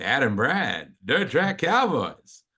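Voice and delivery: big booming announcer, drawn out and climbing high; John Blackman impression. Host recalling the TV voice-over man introducing the band, hyped announcer. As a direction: stretch it out into a loud, high whoop. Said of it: "John Blackman's going".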